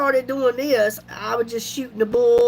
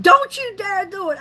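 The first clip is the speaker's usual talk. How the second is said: daughter-in-law's warning; 7 semitones higher than usual. Retold or imitated. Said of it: "my daughter-in-law said".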